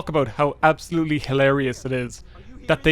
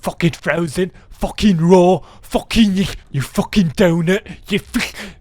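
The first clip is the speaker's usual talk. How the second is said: loud mock chef tirade; hammed-up angry kitchen rant; Gordon Ramsay; 2 semitones higher, 6 dB louder, slower than usual. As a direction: bellow it like a raging TV chef. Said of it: "I was looking at Gordon Ramsay".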